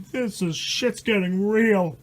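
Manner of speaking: plain reading voice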